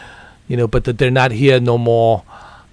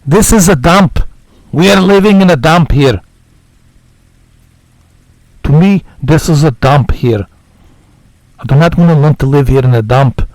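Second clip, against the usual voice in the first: Nico Bellic voice; 4 dB louder than usual. Pretend. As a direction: play a blunt immigrant hero who is fed up with the city. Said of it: "My Nico Bellic is going away".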